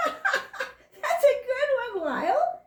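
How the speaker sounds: high, slow laugh through the words. Acted. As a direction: chuckle it out, amused by the joke. Skunk laughs at crocodile's joke.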